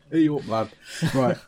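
normal talking voice

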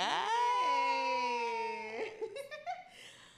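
shrieking with joy; loud excited laugh-scream. Real, not acted. Excited end of first episode.

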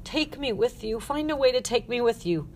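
high-pitched begging; desperate pleading. Acted. Girl clinging to Ruth's neck, pleading. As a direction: beg to be taken along.

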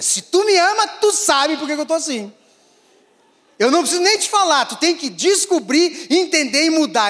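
high, loud mock whine of a sulky wife; hammed-up sulky partner, high and fast; demanding wife. Imita a esposa exigindo adivinhação. (esposa emburrada imitada, aguda e alta)

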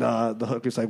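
ordinary talk

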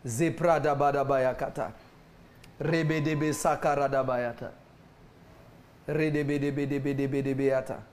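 speaking in tongues. Real, not acted.

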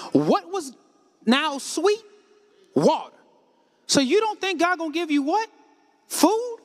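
ordinary delivery

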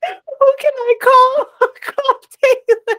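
high, loud laughing wail; drawn-out panicked cry breaking into laughter; mock panic. Recalling her first reaction to call Taylor, laughing mock panic.

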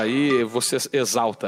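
ordinary talk, nothing marked

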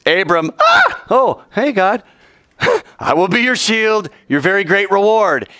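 casual God. Acted. God casually hailing Abram, high and laughing. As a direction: play God breezy and friendly.

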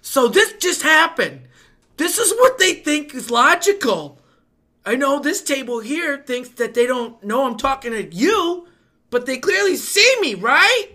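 slow, loud, high sarcastic complaint; exasperated rant. Retold or imitated. Waiter venting to his tables about others, exasperated. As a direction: spell it out slowly, loud and incredulous. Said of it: "I go to my tables".

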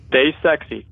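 ordinary talk

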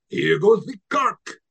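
put-on high, slow Spanish-accented flourish; hammed-up Puss in Boots ride announcement; Antonio Banderas. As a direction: stretch it out like a swashbuckling cartoon cat. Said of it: "he does the voice".

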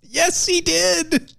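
high, slow proclamation; mock herald announcing; town crier.

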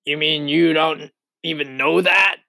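jeering storybook centipede; incredulous cry. Acted. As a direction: cry out in disbelief at such ignorance. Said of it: "cried the centipede".

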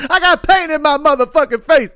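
high-pitched outraged imitation through laughter; hammed-up dad losing it, cracking up; mock flipping out. Comedian mimics dad with paint on his face, high laughing mock outrage. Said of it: "the guy flipped the fuck out".

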